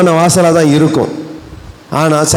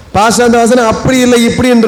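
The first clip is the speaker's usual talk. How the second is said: a lightly voiced believer objecting to the pastor; questioning believer; 4 semitones higher than usual. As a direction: object politely, like a church member who knows the verse. (கேள்வி கேட்கும் விசுவாசியின் லேசான குரல்)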